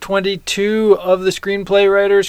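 high, loud holiday host voice; festive announcer. Acted. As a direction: announce it like a Christmas special.

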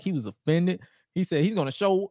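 ordinary delivery

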